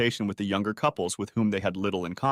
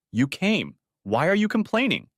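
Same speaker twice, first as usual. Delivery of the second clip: high, pointed retort with forced calm; tartly composed challenge; sharp, contrived calm; 8 semitones higher than usual. A narrator's reading. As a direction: push back sharply, feigning calm. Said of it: "she asked with a sharp, contrived calm".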